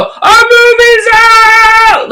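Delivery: high, loud, drawn-out cheer; slow loud drawn-out celebration; excited shout. Retold or imitated. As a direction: stretch it out, bursting with joy. One of us would just go.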